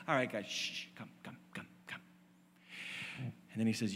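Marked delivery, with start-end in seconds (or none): 0.1-2.0 softly hushing and calling them in, almost a whisper; gentle hush. Retold or imitated. Jesus hushing his quarrelling disciples, very soft. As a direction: gather the squabbling friends quietly.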